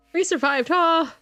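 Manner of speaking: high, excited cheer; celebrating. Real, not acted. Player celebrating survival in the game, excited cheer. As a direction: lift it high in relief.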